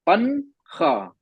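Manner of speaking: पनखा stretched very slowly with a full n; very slow full n demo; drawn-out wrong version. Shown as a demonstration. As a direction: slowly spell out पनखा with the n touched. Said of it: "लेकिन हम कैसे पढ़ते हैं इसको".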